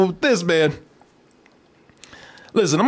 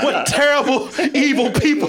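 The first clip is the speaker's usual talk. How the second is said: mock outrage; 7 semitones higher than usual.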